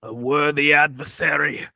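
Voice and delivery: loom over them like a dark lord. Acted. Mock warrior challenge.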